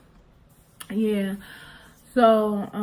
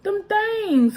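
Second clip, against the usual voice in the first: high and a bit louder, insisting; insistent high child; 11 semitones higher, 5 dB louder than usual. Retold or imitated. Girl insisting to mom, high and emphatic. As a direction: push it like a kid who can't name it. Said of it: "I'm like".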